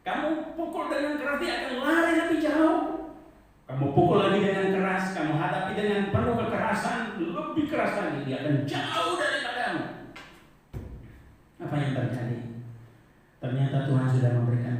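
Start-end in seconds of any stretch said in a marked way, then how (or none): 0.1-9.8 high, loud preaching cry; impassioned preacher pleading loudly; fiery preaching. Acted. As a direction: drive the warning home from the pulpit. Preacher at full force.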